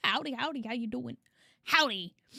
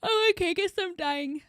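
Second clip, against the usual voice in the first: mock alarm; 4 semitones higher, 3 dB louder than usual. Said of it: "and I was like".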